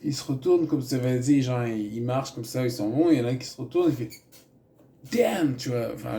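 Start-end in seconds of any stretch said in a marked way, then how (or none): 5.1-5.5 much higher, drawn-out American "Damn"; an impressed American tourist turning round. Pretend. Tourist reacting at Notre-Dame, mock American. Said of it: "je vais pas le faire en V O V".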